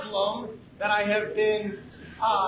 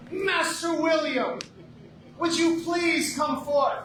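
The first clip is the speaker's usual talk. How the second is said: raised, high calling voice; ceremonial summons; 7 semitones higher than usual. Acted. Lord summoning Master William to come forth, grand high call. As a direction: call out grandly across the hall.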